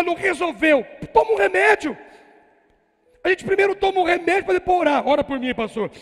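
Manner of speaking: loud, high fiery sermon; full-force preaching. Real, not acted. Pastor urging believers to fight, preaching loud. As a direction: drive it home to the congregation.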